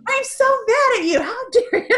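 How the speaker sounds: very high, loud retold tirade; yelling at him. Mother scolding her son's spirit, hammed-up furious yell. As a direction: shriek the scolding, breaking into laughter. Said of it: "like, yelling at him".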